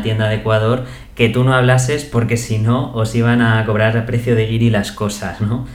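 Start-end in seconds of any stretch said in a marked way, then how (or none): none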